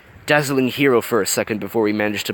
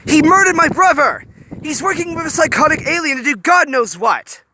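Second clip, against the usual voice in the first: furious shout; 6 dB louder than usual. A narrator's reading. Hiro shouting about brother's killer.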